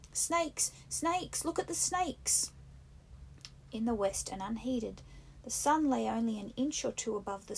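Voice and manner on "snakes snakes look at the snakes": high frightened cries; children screaming. A narrator's reading. Little boys scream at the burning creepers in terror. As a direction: shriek it like terrified little boys. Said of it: "little boys screamed".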